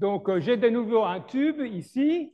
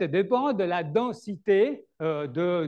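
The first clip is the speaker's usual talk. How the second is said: normal talking voice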